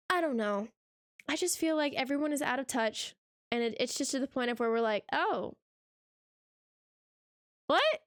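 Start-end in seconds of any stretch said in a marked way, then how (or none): 5.1-8.0 mock baffled "what?" jumping high; a viewer squinting at modern art in disbelief. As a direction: pretend confusion, pitch shooting up.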